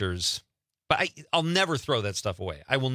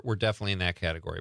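usual speaking voice

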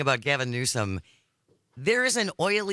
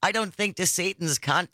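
normal talking voice

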